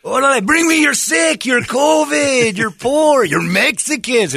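high, loud mock-Mexican accent; Mexican Statue of Liberty. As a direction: holler it like a costumed Mexican street hawker. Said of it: "a Mexican man dressed as a Statue of Liberty".

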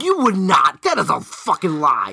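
loud, indignant calling-out; loud disbelief. Real, not acted. Telling co-host he would never ask them, loud disbelief. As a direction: call the bluff at full volume. Disputing co-host's boast.

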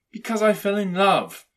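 high, loud, ardent answer; earnest nephew. A narrator's reading. Nephew explains his marriage to Scrooge, earnest. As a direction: declare it openly, pitched high.

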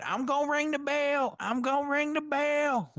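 hammy high-pitched impression of an excitable ref; Mark Briscoe. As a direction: hold a high pitch and stretch out the warning. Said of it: "all of his mannerisms and shit".

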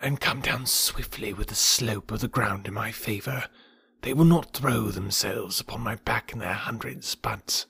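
soft, unvoiced snake whisper. A narrator's reading. Kaa whispering the attack plan to Bagheera. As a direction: keep it hushed and secret. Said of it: "Kaa whispered".

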